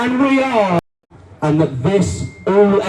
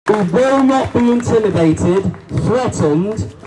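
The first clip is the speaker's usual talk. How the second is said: normal talking voice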